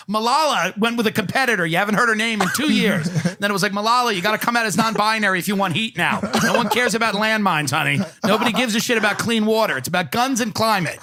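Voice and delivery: hammed-up agent pitching to a child client; sleazy agent. Agent pushing Malala to rebrand, hammed-up pushy voice. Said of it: "It was this, this agent who was like".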